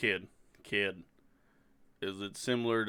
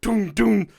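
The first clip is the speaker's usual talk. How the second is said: booming footsteps sound; 11 semitones higher, 12 dB louder than usual. Acted. Footsteps of a metal giant.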